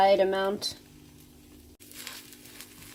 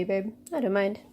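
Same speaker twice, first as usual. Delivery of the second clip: usual speaking voice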